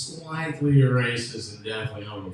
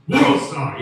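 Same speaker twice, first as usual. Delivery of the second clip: higher, louder, slowed hype chant; hype call; 4 semitones higher, 9 dB louder than usual. Retold or imitated. Comic pushing the street fight hype call, loud. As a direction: drag it out like a hype man.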